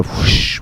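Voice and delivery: breathy, voiceless rushing sound effect; whoosh. Acted. The mist rushes through his legs, breathy whoosh.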